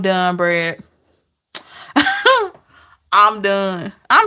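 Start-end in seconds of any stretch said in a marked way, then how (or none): 1.9-2.5 short high laugh; high burst of laughter. Real, not acted. Laughing after joke.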